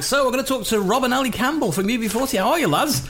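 high-pitched, chirpy interviewer greeting; radio interviewer. Retold or imitated. Radio presenter greets two band members down the line, upbeat interviewer voice. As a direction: put on an upbeat on-air hello. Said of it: "And I was like".

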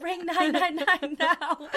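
high, loud, drawn-out yell through laughter; laughing mock scream, slow and high; retold screaming. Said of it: "screaming at this guy".

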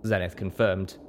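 usual speaking voice

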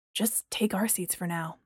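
soft, offhand whisper; dismissive. A narrator's reading. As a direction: brush it off quietly. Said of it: "Apollo said dismissively".

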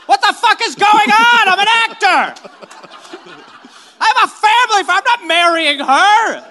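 loud, high, frantic protest; panicked actor. Pretend. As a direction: panic and yell at the set. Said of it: "every Star Wars actor has actually said that".

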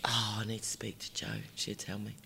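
whispered aside; whispering to herself. Real, not acted. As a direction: drop to a whisper to plot. Aside about asking Jo.

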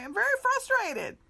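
high, loud honest complaint to the cashier; honest frustration, said out loud. Retold or imitated. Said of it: "I was able to say".

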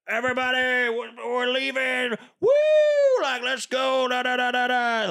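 high, slurry mock of a rowdy drunk; drunk guy. Mocking the drunk guy on the boat, high and rowdy. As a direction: ham up a tipsy partier calling out. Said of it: "some drunk guy's like".

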